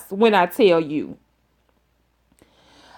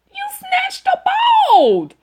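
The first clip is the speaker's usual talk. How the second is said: disbelieving shout; slower than usual. Real, not acted. Teller shouting at Tamika about the fight, high and amazed. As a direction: stretch out bald in amazed outcry.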